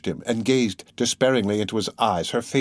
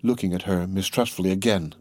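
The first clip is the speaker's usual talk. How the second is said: plain reading voice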